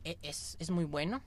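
normal talking voice

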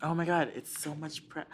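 usual speaking voice

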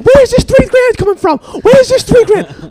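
very high, loud furious demand; Tony Soprano tough guy. Retold or imitated. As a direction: shout it like a mob boss who's been cheated. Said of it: "getting, like, really Tony Soprano".

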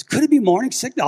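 eager question. Retold or imitated. Dad teasing daughter hopefully.

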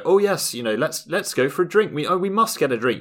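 mock-posh enthusiasm at a chance meeting; gushing English politeness. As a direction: play the overkeen Brit who'll never follow through. Imitates gushing English small talk.

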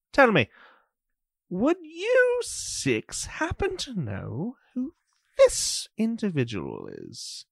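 a curious official, mildly amused; haughty magistrate. Acted. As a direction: Magister Morden questions the six strangers, haughty and probing. GM voices Magister Morden.

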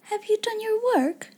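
put-on high, girlish question; high, kindly young-girl inquiry; different, practical tone. A narrator's reading. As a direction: ask simply in a child's high voice. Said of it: "she spoke in a different tone".

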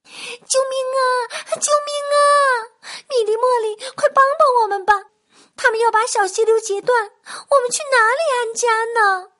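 high, loud panicked shrieks of frightened water birds; frantic squeaky calls for rescue; panicked cry for help. Acted. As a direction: scream for help as terrified little birds. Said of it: "惊慌万分地喊着".

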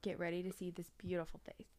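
smug playful whisper. Real, not acted. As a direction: whisper it with a grin. Joking self-praise aside.

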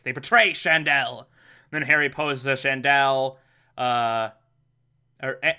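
usual speaking voice

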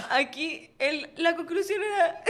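words breaking into giggles, high; stifled giggles. Real, not acted. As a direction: try to talk through the laughter. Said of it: "no puedo aguantar la risa".

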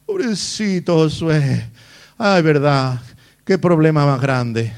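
slow, drawn-out fake sympathy; mock consoling. Preacher imagines God consoling poor Joshua, mock pity. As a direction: pity him with exaggerated slowness. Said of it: "te va a consolar".

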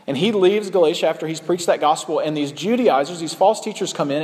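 usual speaking voice

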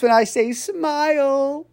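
high, stretched smiling voice; big smile. Shown as a demonstration. Coach demonstrating body language to listeners, big smiling voice. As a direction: grin through it and draw out "smile". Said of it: "I got a big smile on my face".

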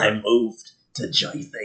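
put-on low, slow Jersey accent; mock New Jersey accent. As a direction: lay on the Jersey slowly. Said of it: "you have a New Jersey accent".

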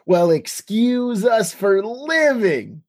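Dave Goelz's lead penguin. Retold or imitated. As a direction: stretch it out as an affronted penguin's retort. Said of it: "the way that Dave Goelz, as the lead penguin, says".

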